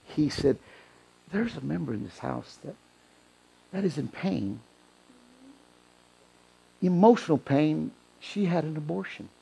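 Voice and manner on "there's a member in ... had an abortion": very soft, hushed retelling; confiding in an ear. Retold or imitated. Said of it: "he went to his ear".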